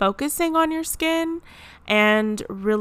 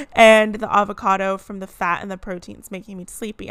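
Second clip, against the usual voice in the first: ordinary talk, nothing marked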